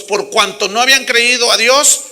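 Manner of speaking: loud, driving sermon voice; full-force preaching. Acted. Pastor thunders at the congregation, full-force preaching. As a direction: preach it to the back row.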